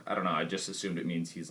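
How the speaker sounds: normal talking voice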